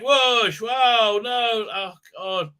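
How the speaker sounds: high, loud whooshes and cries; hammed-up combat yelps; mock fight noises. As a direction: act out a battle with your mouth.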